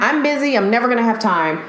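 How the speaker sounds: usual speaking voice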